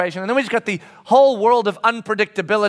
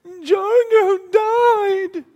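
high, drawn-out mock child's wail; weeping wail; 5 dB louder, much slower than usual. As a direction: imitate a little boy bawling, stretching each word. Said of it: "just starts weeping, and he says".

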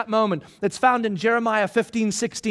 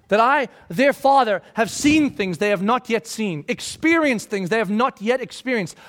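ordinary talk

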